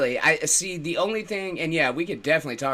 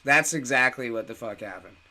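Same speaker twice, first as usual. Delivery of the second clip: usual speaking voice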